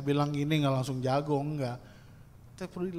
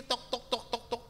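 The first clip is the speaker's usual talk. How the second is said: higher, insistent knock-knock; mock knocking; 6 semitones higher, 6 dB quieter than usual. Preacher mimics believers pounding on God's door, raised and insistent. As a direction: hammer at the door in voice.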